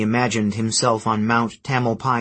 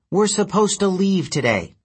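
put-on girl voice; 8 semitones higher than usual. Girl continues.

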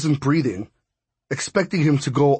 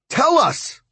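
much higher, louder demand; urgent demand; 14 semitones higher, 3 dB louder than usual. Acted. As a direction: push hard for the answer. Demanding Brooks explain.

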